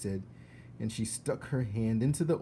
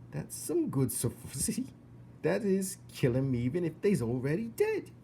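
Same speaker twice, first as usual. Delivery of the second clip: put-on simple girl, giggling; 6 semitones higher than usual. Mig repeats Cook, laughing.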